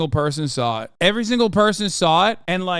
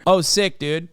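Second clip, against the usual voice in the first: usual speaking voice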